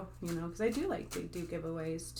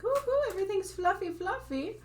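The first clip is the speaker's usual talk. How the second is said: much higher, breathy-free mock sweetness, sing-songy ooh ooh; mocking airy voice; 13 semitones higher, 5 dB louder than usual. Reader mocking fluffy spiritual messages to viewers, high mock sweetness. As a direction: lampoon the fluffy light-message crowd.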